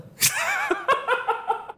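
high falling laugh; laughing at the late diagnosis. Real, not acted.

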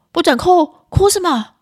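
high, loud scolding voice; irritable mother. Acted. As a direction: act out an exasperated mum cracking down on crying. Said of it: "暴躁的妈妈都会这样说". (暴躁妈妈高声喝斥)

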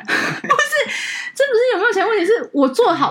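laughing, defending yourself; laughing protest. Real, not acted. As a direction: giggle through the denial. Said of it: "被大家听到会觉得你好像很市侩". (边笑边辩解)

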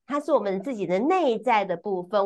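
usual speaking voice

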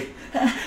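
high, breathless titter; a quick scared giggle; coworker's shaky laugh; nervous little laugh. Retold or imitated. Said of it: "我那个同事在旁边".

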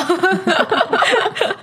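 high nervous burst of laughter; spooked, helpless laughter. Real, not acted. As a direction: laugh out the fright. Reacting to scary story.

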